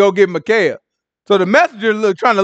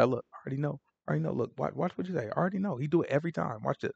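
hushed aside; 3 semitones lower, 17 dB quieter, faster than usual. Retold or imitated. Ahab aside to Jehoshaphat.

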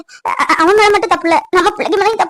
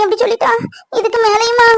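crying; 4 semitones higher than usual. Acted. Mother despairs at the daughter's words.

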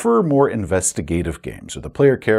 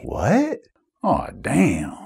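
stunned player; much slower than usual. Acted. Players hear the villain's reveal, mock-stunned. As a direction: react to a twist in disbelief.

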